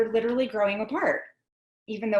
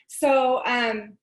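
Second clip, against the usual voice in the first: usual speaking voice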